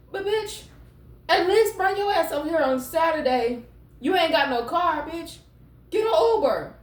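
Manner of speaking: high, sassy scolding of her man; fed-up girlfriend. Acted. Reader voicing a woman scolding her boyfriend, high and sassy. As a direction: tell him off, high and sassy.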